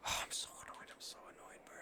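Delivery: very soft, voiceless muttering to oneself; annoyed whisper. Real, not acted. Streamer rueing a lost fight, exasperated whisper. Seething after losing to a player.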